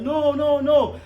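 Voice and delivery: high, loud desperate no's; screaming no. Retold or imitated. Husband reliving the birth, high desperate no's. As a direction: cry out no in panic. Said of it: "And I'm screaming".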